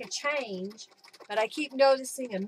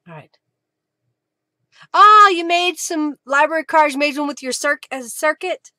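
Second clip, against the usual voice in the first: ordinary talk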